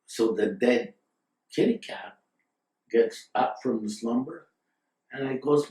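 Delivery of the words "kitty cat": ordinary delivery, nothing marked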